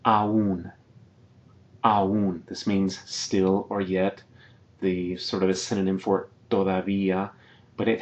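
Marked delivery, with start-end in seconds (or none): 0.1-2.4 Aún as a-ún, stress on ú; syllables separated. Shown as a demonstration. Teacher models Aún, a and ú apart. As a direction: split Aún, hit the ú. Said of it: "we're gonna separate".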